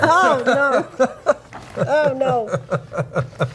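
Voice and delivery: giggling through the words; laughing. Real, not acted. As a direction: say it while cracking up. Laughing after the cancer joke.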